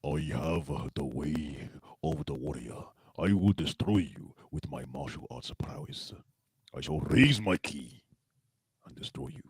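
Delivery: slow anime warrior in a Japanese accent; deliberate, solemn anime-style Japanese accent; cliche anime Japanese accent. Shown as a demonstration. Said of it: "anime Japanese accent".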